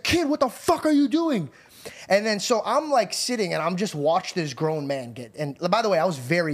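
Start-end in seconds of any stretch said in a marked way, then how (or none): 0.0-1.5 high-pitched imitated outrage; retold angry scolding. Uncle rebuking the kid, mock angry yell. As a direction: mimic a furious uncle scolding.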